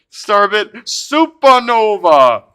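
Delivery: high, loud, drawn-out card call; triumphant announcing. Real, not acted. Streamer celebrating the card just pulled, triumphant drawn-out shout. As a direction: proclaim it big and slow, falling at the end.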